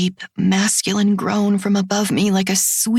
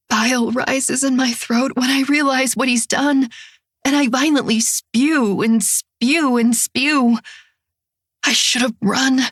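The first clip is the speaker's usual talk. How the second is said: usual speaking voice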